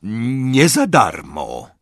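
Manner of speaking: slow, firm goblin. Acted. Goblin insists to Harry, slow and firm. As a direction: lay down the terms slowly. Goblin sets his price.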